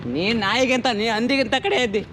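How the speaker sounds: loud, heated name-calling; angry insult. Real, not acted. Rider insults the other man in a quarrel, angry. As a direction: fling the insult loudly.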